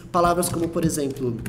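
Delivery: ordinary talk, nothing marked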